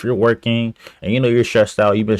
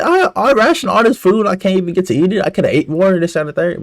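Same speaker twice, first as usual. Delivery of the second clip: high, outraged whine; indignant complaint; 11 semitones higher than usual. Retold or imitated. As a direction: complain like you've been cheated. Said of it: "I'm like".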